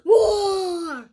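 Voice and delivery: put-on a child's growly troll roar, laughing; playful kid troll roar. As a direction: roar like a scary troll teacher. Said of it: "What do you think her troll teacher sounds like?".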